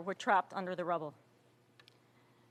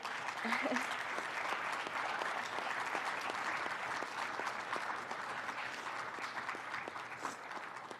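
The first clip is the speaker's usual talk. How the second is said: breathless embarrassed laugh; 3 semitones higher, 12 dB quieter than usual. Real, not acted. Nosebleed interrupts her speech.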